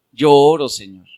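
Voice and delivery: normal talking voice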